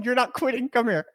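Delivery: high-pitched, laughing through the words; amused, squeaky-high quote through laughter; laughing retelling. Retold or imitated. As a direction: retell it while cracking up. Cracking up while retelling boss's line.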